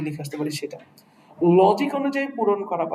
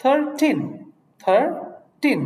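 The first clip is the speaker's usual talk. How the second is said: Thirteen pitched higher and louder on "টিন"; thirteen with a higher, louder final syllable; stress on the end of thirteen; 7 semitones higher, 4 dB louder, much slower than usual. Shown as a demonstration. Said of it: "জোর পেল".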